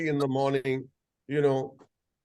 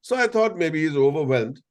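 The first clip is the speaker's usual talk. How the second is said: usual speaking voice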